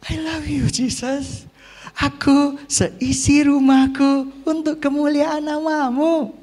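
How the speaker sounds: high, stretched-out worshipful voice; hammed-up pious vow; mock devout declaration. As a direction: imitate a gushing believer pledging everything. Mimics easy devotion of the moneyless.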